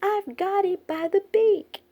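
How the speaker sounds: high, loud cub's cry of triumph; excited cry. A narrator's reading. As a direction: shout out the find in a tiny voice. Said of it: "Baby Bear cried".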